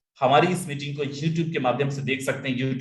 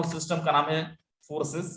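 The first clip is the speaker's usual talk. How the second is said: ordinary talk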